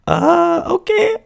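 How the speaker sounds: very high, thin little voice; light voice. Retold or imitated. Small boy answering the apology, very high light voice. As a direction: squeak it out high like a small kid. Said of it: "With my, uh, light voice".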